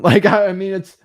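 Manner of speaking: ordinary talk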